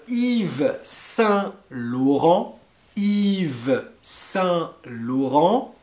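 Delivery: "Yves Saint Laurent" drawn out slowly; slow model. Shown as a demonstration. Teacher slowly modelling "Yves Saint Laurent" for learners. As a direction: spell it out with your voice, slowly. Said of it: "repeated slowly".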